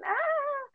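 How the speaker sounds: high mock yell of a jeering classmate; a high teasing "Ah!" shout. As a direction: yelp it out high, teasing the boy.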